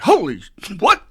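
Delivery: sudden high yelp of shock; shocked outburst. Retold or imitated. Addict at the wreck, startled loud yelp. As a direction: blurt it out, jolted by the crash. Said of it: "you're gonna be like".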